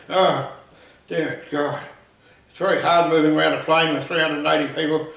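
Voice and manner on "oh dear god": ordinary delivery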